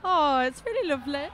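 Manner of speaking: a passing hint of a Birmingham accent on "really lovely"; accidental touch of Birmingham accent; slipped into Birmingham. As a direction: let "really lovely" slide briefly into a light Brummie accent. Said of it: "I went really Birmingham for a second then".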